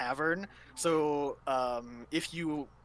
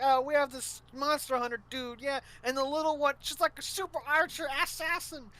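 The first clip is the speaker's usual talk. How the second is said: boastful; 9 semitones higher, 5 dB louder than usual. Retold or imitated. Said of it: "really playing up the party".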